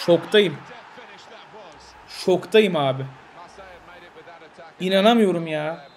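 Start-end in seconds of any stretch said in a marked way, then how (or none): none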